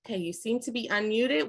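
ordinary talk, nothing marked

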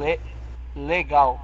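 a stutterer forcing out legal; stuttered word. Acted. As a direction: stammer over legal, then push it out. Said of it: "ele é gago".

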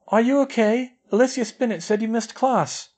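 put-on a girl calling to her crying friend; coaxing schoolgirl. A narrator's reading. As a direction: coax a friend out gently. Parvati coaxing through door.

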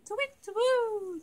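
high falling owl hoot; hooting high, sliding down. Shown as a demonstration. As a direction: call out like an owl. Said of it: "They hoot".

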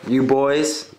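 ordinary talk

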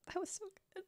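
ordinary talk, nothing marked

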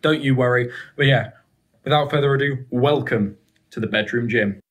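ordinary delivery

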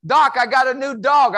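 high, loud, eager player; mock excited football player. Speaker imitating a Steeler bragging about his new dog. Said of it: "I had a Steeler once go".

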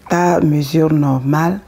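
ordinary talk, nothing marked

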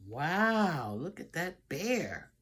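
usual speaking voice

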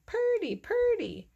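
very high chirped answer; bird song. A narrator's reading. A bird answers Mole's whistle, high chirping. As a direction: sing it back like a little bird. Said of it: "somewhere a bird sang".

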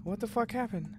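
ordinary delivery

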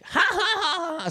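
very high-pitched fake laugh; mock burst of laughter. Retelling Annie looking at the phone, high mock laughter. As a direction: imitate someone busting up laughing. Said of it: "busting up laughing".

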